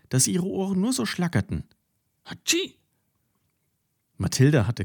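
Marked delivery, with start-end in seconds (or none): 2.2-2.8 very high, sharp sneeze; one last squeaky sneeze; a small sneeze after shaking the head. A narrator's reading. Cow keeps sneezing.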